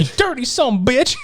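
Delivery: put-on high, scolding old woman; old lady voice. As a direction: scold like a furious granny.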